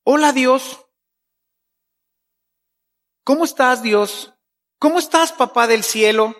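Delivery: chatty and relaxed, talking to a dad; light casual hello to God; casual friendly prayer. Shown as a demonstration. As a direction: greet God like an old friend. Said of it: "y hablarle a Dios así".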